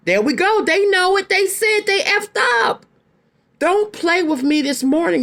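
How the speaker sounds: excited. Real, not acted. Triumphant about the card.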